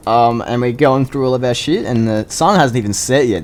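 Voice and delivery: normal talking voice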